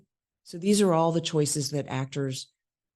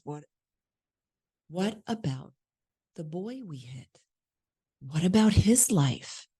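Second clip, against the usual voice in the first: guilt-tripping. Acted. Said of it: "trying to make him feel guilty".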